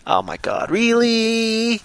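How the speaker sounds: drawn-out, high-pitched disbelief on the last word; mock disbelief. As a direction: react with incredulous dismay. Acts out reaction to the accent.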